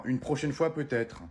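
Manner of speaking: normal talking voice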